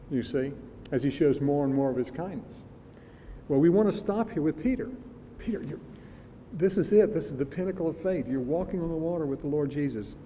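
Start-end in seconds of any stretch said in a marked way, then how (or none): none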